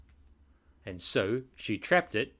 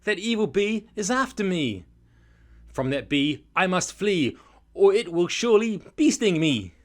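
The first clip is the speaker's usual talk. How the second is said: high, urgent plea in rhyme; hammed-up storybook panic; alarmed cry; 8 semitones higher, 7 dB louder than usual. A narrator's reading. Andy warns Terry the bee is after him, panicked cry. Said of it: "I cried".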